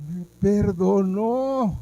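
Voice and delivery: high, loud, slow exclamation of joy; overjoyed, loud and drawn out; joyful cry. Retold or imitated. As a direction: shout it out in relief and joy. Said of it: "Y yo pude hacer".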